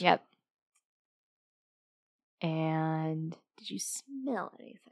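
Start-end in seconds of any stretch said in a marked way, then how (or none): 2.4-4.8 slow, very soft whisper. Acted. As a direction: whisper a question to an object, drawn out. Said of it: "whispering, but not for sound".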